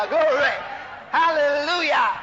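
loud, exultant pulpit shout; shouted praise. Shown as a demonstration. Demonstrates shouted hail.

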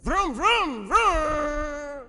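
high, repeated vrooms, the last one held; imitated revving engine. Shown as a demonstration. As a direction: rev up like a car setting off. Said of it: "supposed to be going somewhere".

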